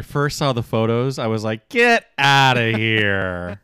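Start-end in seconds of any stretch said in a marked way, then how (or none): 1.7-3.6 slow, loud, drawn-out amazement; exaggerated disbelief. Fan recalling first seeing the photos, drawn-out disbelief. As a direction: stretch each word in mock astonishment.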